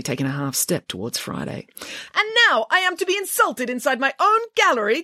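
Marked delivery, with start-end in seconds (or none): 2.2-5.0 very high, affronted director; squeaking with offended pomposity; indignant demand. Acted. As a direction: demand it in theatrical outrage. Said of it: "demanded the director".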